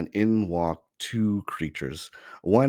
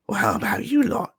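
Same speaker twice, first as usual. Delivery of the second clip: sly goblin; 5 dB louder, faster than usual. Acted. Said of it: "and he goes".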